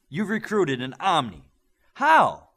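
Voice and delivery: rising to a loud, very high How; astonished. A narrator's reading. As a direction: disbelief bursting out at the end. Coach stunned by news.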